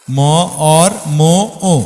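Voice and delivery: ম, অর, ম, ও counted out one per beat; split into syllables. Shown as a demonstration. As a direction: say মর্ম as ম-অর-ম-ও on four beats. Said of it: "পরের চার মাত্রায় দেখেন".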